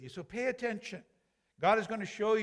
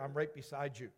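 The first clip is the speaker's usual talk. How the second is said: much softer, lower "I'm right beside you"; gentle reassurance; 4 semitones lower, 7 dB quieter than usual. Shown as a demonstration. God whispers comfort to a struggling believer, softer and lower. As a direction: say it close and tender, as God would.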